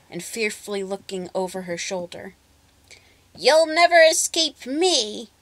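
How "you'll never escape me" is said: much higher and louder, slow gloating threat; menacing witch shout. A narrator's reading. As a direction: gloat down at the fleeing girl. Witch chasing fleeing girl.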